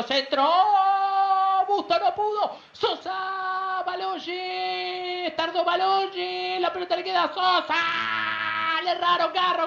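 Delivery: rising, urgent play-by-play; excited commentary. Real, not acted. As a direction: build the tension as the ball drops. Goal chance, rising play-by-play.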